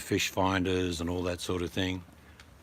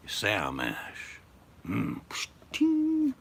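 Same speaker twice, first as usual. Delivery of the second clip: mock ad sound; much slower than usual. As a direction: do a gravel-free whiskey ad voice. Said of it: "What'd you spit on the thing for".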